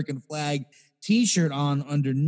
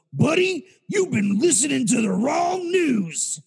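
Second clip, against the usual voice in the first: retold shout; 10 semitones higher than usual. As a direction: bellow it at the poll workers like an angry voter. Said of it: "Shouts it".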